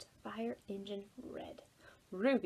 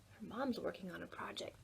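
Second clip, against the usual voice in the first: ordinary delivery, nothing marked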